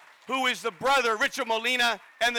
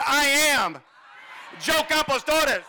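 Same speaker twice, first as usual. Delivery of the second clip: louder, slower rallying shout; saying it louder; 4 semitones higher than usual. Real, not acted. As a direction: belt it out so the family hears. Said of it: "Let's say it louder".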